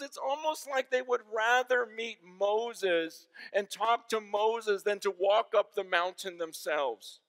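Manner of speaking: high, loud, tearful, slowing at the end; retelling a crying pastor. Retold or imitated. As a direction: plead in bewildered tears. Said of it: "And he's crying".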